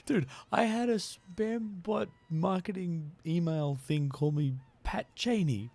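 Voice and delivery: ordinary talk, nothing marked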